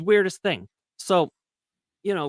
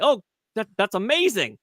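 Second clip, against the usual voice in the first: amazed; 7 semitones higher than usual. Retold or imitated. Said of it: "it's like".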